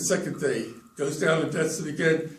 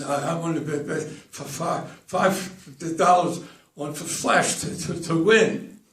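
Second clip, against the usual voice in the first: acted stutter. Charlie bets five dollars at the window, acted stutter. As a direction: stumble haltingly over small words.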